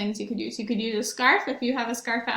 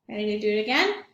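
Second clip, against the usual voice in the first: ordinary talk, nothing marked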